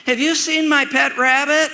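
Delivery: put-on little girl asking. Girl calls asking about her pet rabbit, high and innocent. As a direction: ask innocently like a seven-year-old girl. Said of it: "She says".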